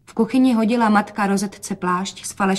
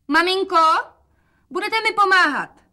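put-on much higher, brighter girl's call; a young girl calling her mother; young daughter voice; 11 semitones higher, 4 dB louder than usual. A narrator's reading. Rozetka volá na matku. (vysoký dívčí hlas)

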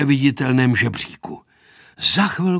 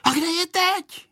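very high, bewildered question; granny baffled by the disappearance; astonished grandmother. A narrator's reading. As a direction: ask in high-pitched disbelief. Grandmother astonished plane vanished.

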